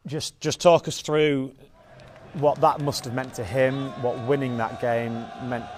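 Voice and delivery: ordinary talk, nothing marked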